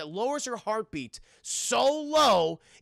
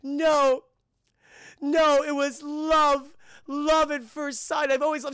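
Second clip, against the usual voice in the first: mock dramatic woman; 9 semitones higher, 7 dB louder, slower than usual. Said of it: "She's like".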